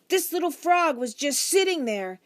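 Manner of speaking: put-on high, loud boy cutting in; children clamouring. A narrator's reading. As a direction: push your story over the din. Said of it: "the talk became louder and louder".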